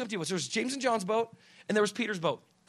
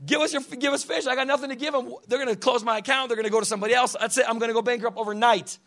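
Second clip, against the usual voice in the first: raised, louder fretting fisherman; mock panic; 9 semitones higher, 8 dB louder than usual. Peter fretting over restaurant orders and bankruptcy, mock panic. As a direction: act out a worried businessman spiralling. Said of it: "Can you imagine the panic".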